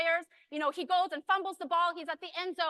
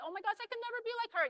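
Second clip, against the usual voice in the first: higher, fast mock fretting; intimidated admirer; 5 semitones higher, 5 dB quieter, much faster than usual. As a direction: gush self-doubt as an imitated onlooker. Voices an intimidated audience member.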